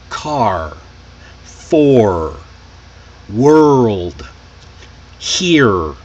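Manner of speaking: "car, for, world, here" with every R fully sounded; strong American R. Shown as a demonstration. Teacher listing example words, strong R in "car, for, world, here". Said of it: "The R is strong".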